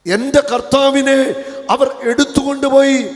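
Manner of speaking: loud, high wail, stress on കർത്താവിനെ; anguished cry. Acted. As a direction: cry out for the lost Lord. Repeats Mary's lament loudly. (ഉച്ചത്തിലുള്ള വിലാപം)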